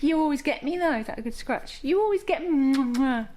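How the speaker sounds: high cooing, mock complaint. Real, not acted. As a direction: coo at the kissing dog. Dog kisses her.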